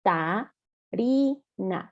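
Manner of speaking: "Ca, ta, ri, na" in separate spaced syllables. Shown as a demonstration. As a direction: break "Catarina" into slow syllables. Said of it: "consonante, vocal". ("Ca, ta, ri, na" sílaba por sílaba)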